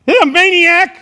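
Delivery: high furious shout at a passing driver; road rage yell. Retold or imitated. Driver yelling at someone who passes him, furious shout. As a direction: scream it like he just cut you off. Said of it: "I'm like".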